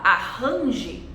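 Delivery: "arrange" with the R turned into a breathy H; forced H sound in place of the R in "arrange". Shown as a demonstration. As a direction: mispronounce "arrange" as "a-hanji", Brazilian style. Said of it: "ri é a pronúncia do H".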